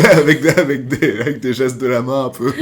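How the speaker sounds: high and loud, laughing. Real, not acted. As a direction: laugh through it. Laughing at own story.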